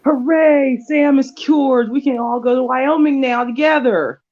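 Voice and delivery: mock cheerful hooray. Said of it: "I really wanted Ellie's blood ... and be like".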